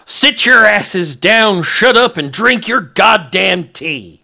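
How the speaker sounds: put-on slow, loud, high southern-accent rant; Sid with southern accent. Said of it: "I always envisioned him with a southern accent".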